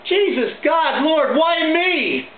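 high, pleading cry to heaven; dying man crying out. Retold or imitated. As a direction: plead up at the sky as you die, stretching the last words. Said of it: "He looks up at the sky and he says".

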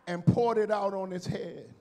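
loud, drawn-out preaching; preaching at full force. Acted. Pastor telling the congregation the story, preaching loud. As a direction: drive the words home to the pews.

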